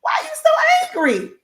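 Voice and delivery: mock high nosy voice, jumping up on so; teasing imitation of someone's remark. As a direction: poke fun at a nosy stranger. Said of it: "and they like".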